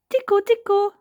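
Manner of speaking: squeaky-high playful tickling; tiny, very high little-boy voice; George. A narrator's reading. Boy tickling grandpa, high and playful. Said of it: "said George".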